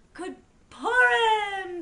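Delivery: loud, high, slow greeting; exuberant holiday shout. A narrator's reading. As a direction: call it out joyfully, stretching Purim. Pinchas greets again.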